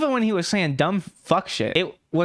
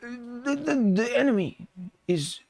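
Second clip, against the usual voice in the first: slow, halting, hammed-up reading off a teleprompter; mock squinting reader; 3 semitones higher, much slower than usual. Mocking Peterson squinting at his flashcards, slow halting read. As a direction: stumble over each word like you can't see the screen. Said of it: "He's like".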